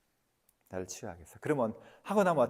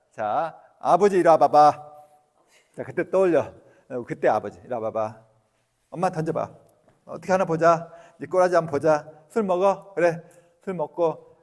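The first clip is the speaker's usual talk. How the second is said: loud, challenging taunts; mock confrontation of father; 4 semitones higher, 9 dB louder than usual. Shown as a demonstration. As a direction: stand up to the drunk father at last. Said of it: "이렇게 하라고".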